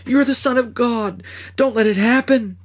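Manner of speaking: desperate begging. Acted. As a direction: beg him to save himself. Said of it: "I begged".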